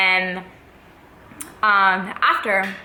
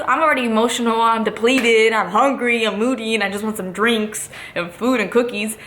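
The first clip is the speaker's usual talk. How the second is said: ordinary delivery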